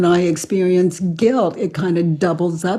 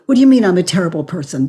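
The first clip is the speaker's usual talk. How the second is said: fast, louder huffy comeback; mock indignant retort; 4 dB louder, much faster than usual. Shown as a demonstration. Answering the accusation, fast and indignant. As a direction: bristle and fire it straight back. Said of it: "da, da, da".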